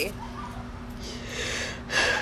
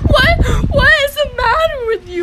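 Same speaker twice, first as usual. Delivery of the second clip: much higher, louder wailing imitation; mock crying sister; 13 semitones higher, 5 dB louder than usual. Friend imitating Genevieve crying, high and wailing. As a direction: mimic a sobbing outraged kid. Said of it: "but she goes".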